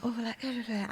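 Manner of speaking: soft, vague murmur. Retold or imitated. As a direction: murmur it under your breath. Staff murmuring worried.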